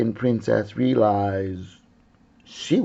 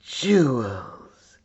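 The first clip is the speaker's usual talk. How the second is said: greedy grin. Acted. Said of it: "with a greedy grin".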